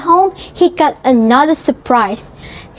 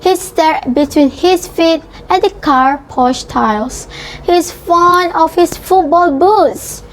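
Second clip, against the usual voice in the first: plain reading voice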